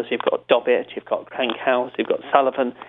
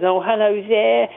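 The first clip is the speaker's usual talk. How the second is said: Robin Cooper voice; 7 semitones higher than usual. Retold or imitated. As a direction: sound genteel and overly polite. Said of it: "he had a certain voice".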